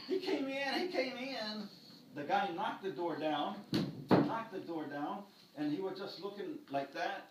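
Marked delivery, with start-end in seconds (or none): 0.1-1.7 high panicked scream; wife screaming. Retold or imitated. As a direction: shriek it in terror, very high and loud. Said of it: "wife is screaming".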